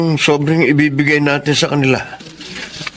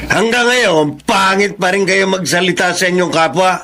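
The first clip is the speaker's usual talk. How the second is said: high, heated rebuke; angry scolding; 8 semitones higher than usual. Acted. Aunt rebuking Frank for his cruel words, angry. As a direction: scold him with rising heat.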